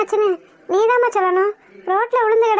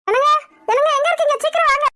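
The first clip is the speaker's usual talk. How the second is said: higher-pitched call for someone to come; urgent calling out; 6 semitones higher, faster than usual. Acted. Wife calling her husband to come quickly, urgent call.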